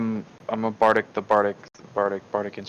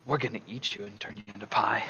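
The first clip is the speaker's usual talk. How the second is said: much softer, eerie menacing murmur; dissonant whisper; 13 dB quieter than usual. Pretend. Bard casting dissonant whispers at the apple snake, soft eerie threat. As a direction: breathe a threat into its mind. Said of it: "what dissonant whispers do you give".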